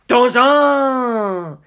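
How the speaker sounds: high, loud gleeful cry; spring it like a magic trick; grinning exclamation. Acted. Funny showing off his trick to Kaz, gleeful cry. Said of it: "he said, grinning".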